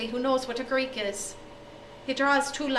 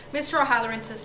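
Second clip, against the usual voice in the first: ordinary delivery, nothing marked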